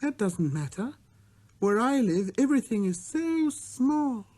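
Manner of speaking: put-on high earnest child's voice. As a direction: say it simply and seriously, like a small boy. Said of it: "said earnestly".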